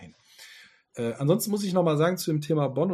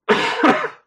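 loud cough turned away; 13 semitones higher, 11 dB louder than usual. Real, not acted. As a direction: cough off mic.

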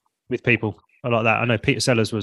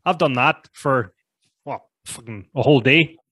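Northern Irish accent. Shown as a demonstration. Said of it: "my Northern Irish accent's not".

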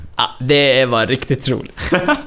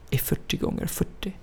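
whisper; 3 semitones higher, 12 dB quieter than usual. Real, not acted. Quiet aside about sitting position.

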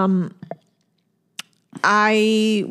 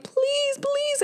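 very high, softer imitated begging; crying, pleading; 5 dB quieter than usual. Mother retelling how she begged in her dream, pleading. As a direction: squeak out a tearful retold plea. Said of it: "I'm crying and I'm pleading".

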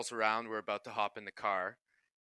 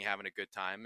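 normal talking voice

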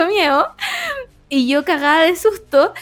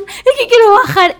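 much higher and louder pleading; panicked plea; 8 semitones higher, 7 dB louder than usual. Retold or imitated. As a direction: beg to leave, frightened. Panicking, wants to go downstairs. (súplica asustada, aguda y fuerte)

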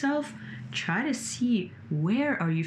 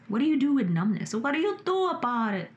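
exasperated question; 7 semitones higher than usual. Retold or imitated. Frustrated self-questioning about numbness.

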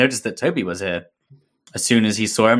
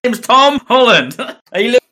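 high, loud mock voice; mock character voice; 13 semitones higher, 6 dB louder than usual. Mocking the spoiler-blurting Frank, high and loud. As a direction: ham up the blabbing character.

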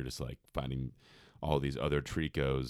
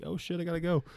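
much higher, a hammed-up panicked gamer; mock panic; 3 dB louder than usual. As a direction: squeal it in a rush of alarm. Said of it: "and you're like".

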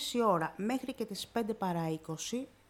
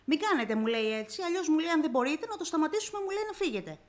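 retold reprimand, higher and louder; raised-voice mimicked rebuke; imitated scolding; 6 semitones higher, 5 dB louder than usual. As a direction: act out someone sharply telling off a patient. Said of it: "μη μου μιλάς έτσι".

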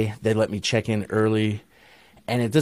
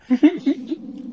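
high burst of laughter. Real, not acted. Laughs at own story.

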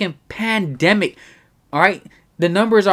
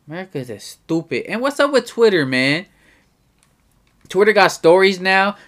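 normal talking voice

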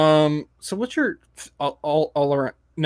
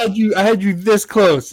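louder, higher, slowed frustration; exasperated; 6 semitones higher, 6 dB louder than usual. Real, not acted. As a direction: groan at the lost chance. Connection dropped mid-persuasion.

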